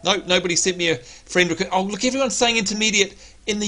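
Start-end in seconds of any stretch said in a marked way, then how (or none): none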